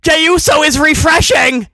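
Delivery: sing-song mock fan whine; mocking clowns. Critic imitating clowns who defend the booking, mock sing-song. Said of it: "For all these clowns that go".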